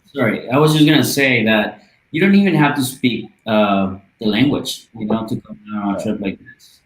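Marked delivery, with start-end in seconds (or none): none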